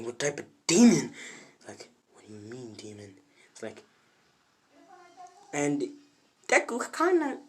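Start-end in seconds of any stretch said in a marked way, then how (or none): 0.0-1.1 loud outburst on "demon"; acted, louder challenge; alarmed demand. Opponent alarmed at failed quirk. 2.1-3.2 low, hushed question; quiet acted confusion; puzzled reply. Deku answers the accusation.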